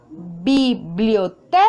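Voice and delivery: biblioteca slowly separated, leaning on te; stress on TE, syllable by syllable. Shown as a demonstration. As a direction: say biblioteca as bi, blio, TE, ca. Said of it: "la sílaba tónica de esta palabra". (biblioteca lento, por sílabas, acento en te)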